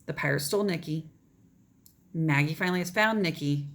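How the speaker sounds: usual speaking voice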